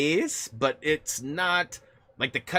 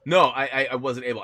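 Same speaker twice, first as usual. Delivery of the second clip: normal talking voice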